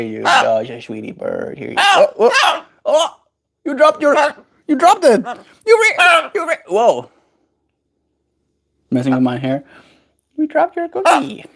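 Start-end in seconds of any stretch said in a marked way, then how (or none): 2.2-7.1 high, startled cries; surprised. Real, not acted. Bird dropped the cookie. 10.4-11.4 talking to a pet. Real, not acted. High to the bird.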